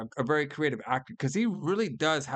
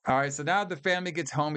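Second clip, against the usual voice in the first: ordinary talk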